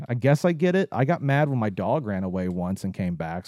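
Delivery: ordinary talk, nothing marked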